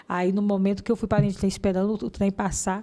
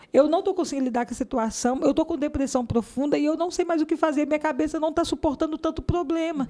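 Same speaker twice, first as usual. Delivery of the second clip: higher-pitched, pleading outpour; retold desperate plea; 5 semitones higher than usual. Woman retelling her plea to a passing couple, desperate. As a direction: re-enact begging for relief from torment.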